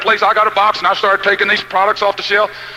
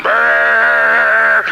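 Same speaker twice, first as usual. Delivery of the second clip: engine rumble. Retold or imitated. Imitating the old car's engine.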